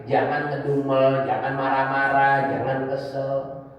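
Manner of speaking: ordinary talk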